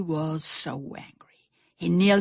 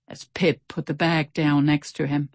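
ordinary delivery, nothing marked

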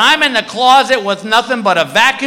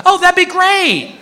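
high, slower mock-wife voice; cheery wife; 10 semitones higher, slower than usual. Wife welcoming friends in for a martini, imitated cheery. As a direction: imitate a wife agreeing brightly in a raised pitch.

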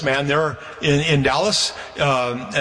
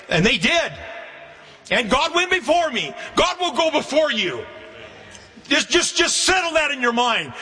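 raised, ringing preacher's voice, pitched well higher; preaching; 8 semitones higher than usual. Acted. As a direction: lift it up and proclaim to the congregation. Preaching at full force.

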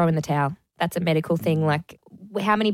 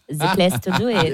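put-on French accent. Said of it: "do it in a French accent".

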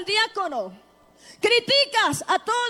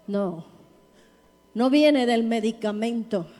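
low, weighty declaration with long pauses; deep, deliberate pulpit exhortation; solemn preaching; 7 semitones lower than usual. Real, not acted. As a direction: drop the voice low and let each statement land. Preaches in a lowered voice.

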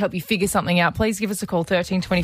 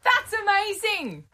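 mock testimonial; 13 semitones higher, slower than usual.

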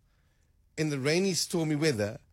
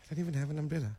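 muttered aside; 7 dB quieter, much faster than usual. Acted. Acted aside to oneself, dropped voice.

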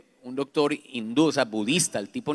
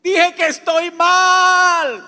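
much higher, loud cry of release; triumphant shout; 12 dB louder, much slower than usual. Retold or imitated. Finally admitting he's not well, triumphant shout. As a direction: shout it like a breakthrough. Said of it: "yo grité y dije".

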